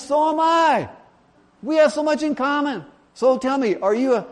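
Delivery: very high, loud mock elation; hammed-up, high-pitched glee; overjoyed. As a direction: gush over a new soulmate. Joke character overjoyed at match.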